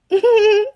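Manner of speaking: short high chuckle; real high-pitched little laugh. Real, not acted. Storyteller laughing at her own naming story, amused chuckle.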